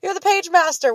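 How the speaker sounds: high excited exclaim; drunken excited shout. Retold or imitated. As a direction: call it out giddy and tipsy. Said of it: "I was fucked up".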